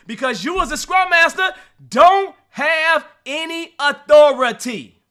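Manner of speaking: mock authoritative voice, "authority" drawn out slow and loud. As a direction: declare it like the boss you aren't. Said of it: "Did you hear me say that with authority?".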